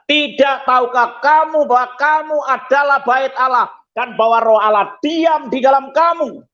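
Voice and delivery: high, ringing preacher's recitation; scripture declamation. Acted. Preacher quoting Corinthians to the congregation, declaiming high.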